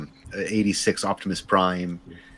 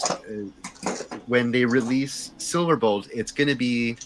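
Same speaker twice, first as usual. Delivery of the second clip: normal talking voice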